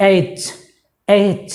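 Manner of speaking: এইচ ending in a hard, pressed ch; correct pronunciation. Shown as a demonstration. Teacher modelling the letter after correcting it, firm ch ending. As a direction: put pressure on the final চ of এইচ. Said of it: "চর উপরে একটা pressure পড়বে".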